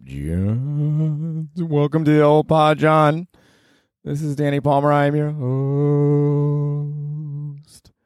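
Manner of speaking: hammed-up welcome to John; silly host voice.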